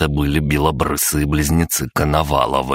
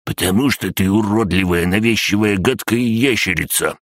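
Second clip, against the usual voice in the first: gloating; 5 semitones higher than usual. A narrator's reading. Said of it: "злорадно прошипел".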